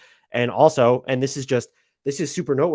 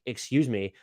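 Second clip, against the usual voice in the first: normal talking voice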